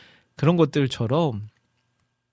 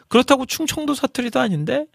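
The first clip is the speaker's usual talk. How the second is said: high-pitched puzzled questioning; mock puzzlement, high questioning tune; puzzled listener; 10 semitones higher than usual. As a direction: voice a listener wondering aloud, pitched up. Voices a puzzled listener hearing mixed dialect.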